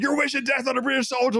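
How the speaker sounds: high, whiny mock outrage from the soldier; mock outraged soldier. A gamer mocking the soldier's whiny outrage. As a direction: squeak it like a prissy offended gamer.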